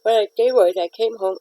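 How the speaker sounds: talking through a yawn; words stretched by a yawn. Real, not acted.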